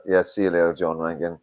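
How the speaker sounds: normal talking voice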